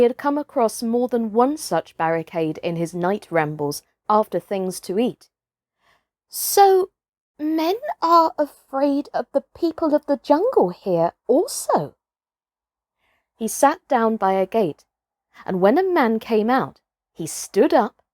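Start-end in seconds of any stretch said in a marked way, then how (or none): 6.3-12.0 a hint of a curious wild boy, pitched up; wondering aloud, a touch higher; lightly voiced boy Mowgli. Mowgli wondering to himself at the gate, lightly voiced.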